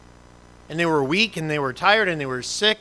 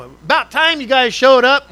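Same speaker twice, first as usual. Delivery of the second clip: much higher, much louder, slow and drawn out; mock relieved old driver; 9 semitones higher, 11 dB louder than usual. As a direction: crow it like a smug old driver seeing the cop finally arrive.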